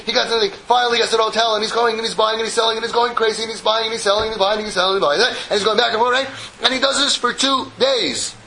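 ordinary talk, nothing marked

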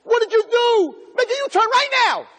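shrieking wife. Pretend. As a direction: yell it at the husband driving. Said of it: "Your wife's in the car".

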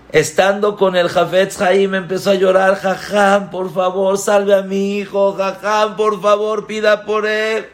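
high, loud desperate begging; pleading mother. Acted. Mother begging the Hafetz Haim, high and desperate. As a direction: beg for your only son's life.

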